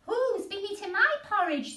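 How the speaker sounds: an indignant mother bear asking; Mummy Bear. Acted. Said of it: "said Mummy Bear".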